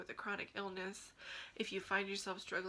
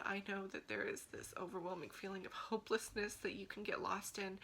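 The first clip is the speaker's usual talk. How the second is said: ordinary delivery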